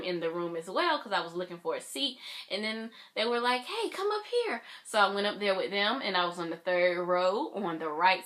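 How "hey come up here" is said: mock a high-pitched beckoning; calling over. New friends waving her up to their seats, calling over.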